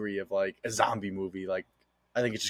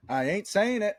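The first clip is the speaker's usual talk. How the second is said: much higher-pitched, insistent refusal; emphatic playful refusal; 11 semitones higher, 3 dB louder, slower than usual. Real, not acted. Podcast host refusing to name the secret movie, high and insistent.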